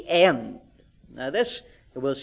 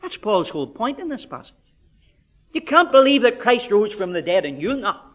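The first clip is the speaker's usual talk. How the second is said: ordinary delivery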